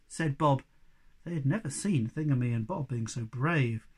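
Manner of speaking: ordinary delivery, nothing marked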